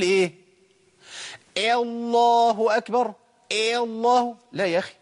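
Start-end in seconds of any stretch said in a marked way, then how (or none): 1.6-4.4 higher, slow chanted الله with its first vowel bent to "eh"; call with imala. Retold or imitated. Imitating those who give the call to prayer, imala on الله. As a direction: stretch the call out, starting الله on "eh" not "a". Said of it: "لا، أنت عملت إمالة".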